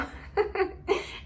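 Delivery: a real breathy giggle up high; airy high laugh. Real, not acted. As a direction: giggle at how funny it is. Laughing at story.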